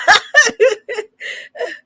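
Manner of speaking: very high, uncontrolled laugh; real laughter. Real, not acted. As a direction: shriek with laughter. Cracks up at the clip.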